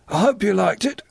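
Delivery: put-on high-pitched young man; high, eager character voice; young Halfways. A narrator's reading. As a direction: ask it in a high, youthful voice. Young Halfways asks John.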